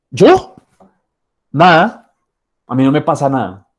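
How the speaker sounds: sulking spouse snaps that nothing is wrong; defensive denial. Retold or imitated. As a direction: insist nothing is wrong while clearly upset. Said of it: "Él está diciendo que no le pasa nada".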